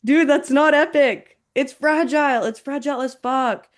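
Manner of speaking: high, incredulous outburst; amused exasperation. Real, not acted. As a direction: exclaim it in exasperated disbelief, fresh from a laugh. Exclaims over what was just laughed at.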